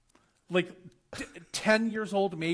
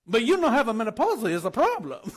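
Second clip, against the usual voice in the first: put-on louder, higher woman's voice with foreign accent; hammed-up foreign accent, raised and emphatic; imitated foreign-accented English; 4 semitones higher, 8 dB louder than usual. Woman explains her menopause to him, imitated foreign-accented English.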